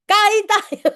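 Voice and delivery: high loud call with a laugh; calling grandma to eat while laughing; laughing shout. Retold or imitated. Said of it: "mataas na yung boses mo".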